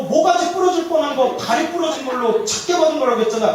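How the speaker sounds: comic flustered denial; mock exasperated outburst. Head monk answers his attendant, mock exasperation.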